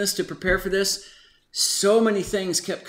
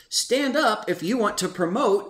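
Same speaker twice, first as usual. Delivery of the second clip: misheard slogan; 5 semitones higher than usual. Retold or imitated. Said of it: "But I heard it as".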